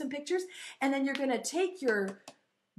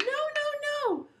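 high, pleading goat; middle goat; 9 semitones higher than usual. Acted. Middle goat begging troll to wait, high-pitched. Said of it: "the middle Billy Goat said".

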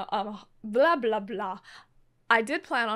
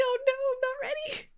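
flustered; 12 semitones higher, faster than usual. Real, not acted. Flustered at stream start.

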